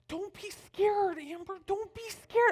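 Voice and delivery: put-on high, drawn-out child's voice; imitated little girl. Little girl urging babysitter to dance, imitated child voice. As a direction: coax it slowly, way up high. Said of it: "the little girl, she says".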